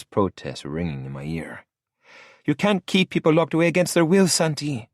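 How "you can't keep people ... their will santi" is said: high, faster echoing protest; remembered Lola protest. A narrator's reading. Santi hears Lola's protest echo while driving, high and quick. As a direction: replay her protest in his head, quicker.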